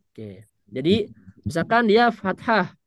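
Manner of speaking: normal talking voice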